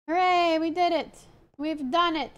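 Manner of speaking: high, joyful victory cheer; excited cheer. Real, not acted. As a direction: cheer like you just won. Celebrating completing something.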